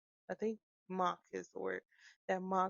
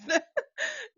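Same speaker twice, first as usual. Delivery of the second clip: high laugh; 4 dB louder than usual. Real, not acted. Laughs after acted quote.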